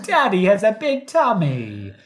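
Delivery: high, loud British cartoon child; hammed-up mock-British kiddie voice; Peppa Pig. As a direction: imitate a cheeky cartoon piglet. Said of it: "Peppa Pig voices".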